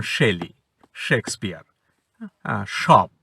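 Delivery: "শেলি, শেক্সপিয়ার, শপ" each opening on sh; SH sound. Shown as a demonstration. Teacher gives S-H examples, clear sh sound. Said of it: "এস এর উচ্চারণ হবে".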